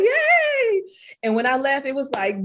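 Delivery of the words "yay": very high squeal of joy with a laugh; high laughing yay; gleeful cheer. Retold or imitated. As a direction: cheer like a kid let out of school. Her joy at leaving.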